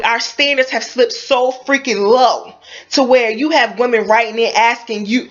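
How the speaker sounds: usual speaking voice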